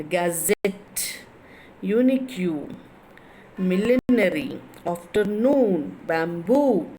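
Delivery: gazette, unique, millenary, afternoon, bamboo, each with the final syllable drawn out; stress on last syllable. Shown as a demonstration. Teacher listing examples for students, each last syllable stressed and prolonged. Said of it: "the last syllable is stressed".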